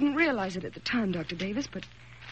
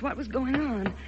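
ordinary talk

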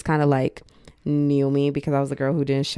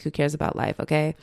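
ordinary delivery

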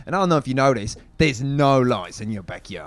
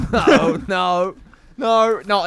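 laughing denial; 8 semitones higher, 6 dB louder, much slower than usual. Real, not acted. Laughing denial, loud high.

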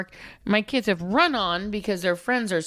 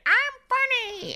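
high, squawky comic voice; Gilbert Gottfried voice. Retold or imitated. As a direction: mimic a squawky comedian. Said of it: "like Gilbert Godfrey".

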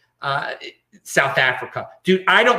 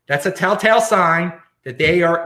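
normal talking voice

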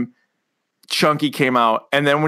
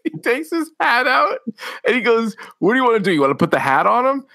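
laughing through it; 8 semitones higher than usual. Real, not acted. Retelling while laughing.

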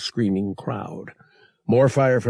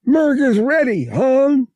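very high, loud creature voice; Murg's character voice; 8 dB louder than usual. Acted. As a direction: answer in a high, forceful alien voice. Murg reports in character voice.

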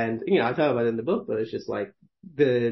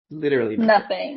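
laughing; 8 semitones higher, 5 dB louder than usual. Real, not acted. Laughing at being paid nothing.

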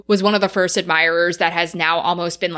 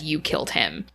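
quiet accusation; 4 semitones lower, 7 dB quieter than usual. Retold or imitated. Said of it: "Mother looks at him and says".

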